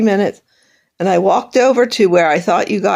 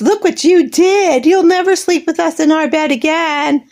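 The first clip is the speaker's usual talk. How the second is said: mock scolding; 6 semitones higher than usual. Imitating mother scolding her for wetting the bed, mock stern. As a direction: mimic the scolding, light. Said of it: "all I got was".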